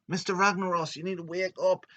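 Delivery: high, loud urgent shout. Retold or imitated. Domo waking Mister Ragnaros, urgent high shout. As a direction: call out to rouse a sleeper. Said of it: "he's shouting".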